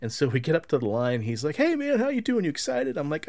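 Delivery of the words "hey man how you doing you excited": much higher, chipper handler voice; mock cheery manager. Manager greeting the fan in line, chirpy and high. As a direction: play the peppy event manager.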